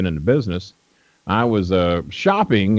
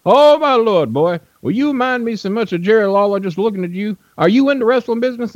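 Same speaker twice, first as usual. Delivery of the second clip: much higher put-on old promoter voice; imitating Nick Gulas; 13 semitones higher than usual. Old promoter greeting a young fan, high imitated voice. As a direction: play the old wrestling boss greeting a stranger, pitched way up. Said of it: "That, that's kinda how he talked".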